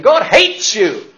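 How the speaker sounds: imitating a harsh minister condemning his flock; condemning preacher. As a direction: thunder it at the congregation. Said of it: "this minister was saying".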